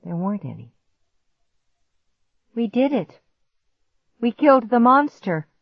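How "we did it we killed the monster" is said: higher, louder triumphant call; ringing out. A narrator's reading. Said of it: "my voice rang out".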